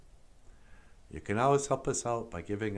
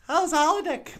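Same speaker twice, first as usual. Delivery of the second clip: very high, loud cartoon puppet greeting; high puppet bonjour; 5 dB louder than usual. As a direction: squeak it out like a bubbly puppet. Puppet character voice.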